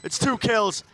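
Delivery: esports caster. Real, not acted. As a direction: ride the clutch to a shout. Live play-by-play call, hype build.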